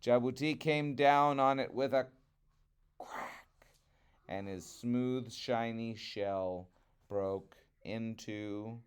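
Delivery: plain reading voice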